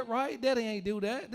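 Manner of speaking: ordinary delivery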